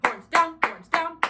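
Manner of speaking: loud, high, punchy drill command; commanding call. Shown as a demonstration. Coach demonstrates the third band command, commanding call. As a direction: call it out hard, hitting DOWN. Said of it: "So same thing".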